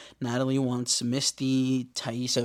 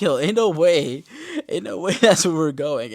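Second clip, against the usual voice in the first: laughing disbelief; 4 semitones higher, 7 dB louder than usual. Retold or imitated. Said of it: "And I was like".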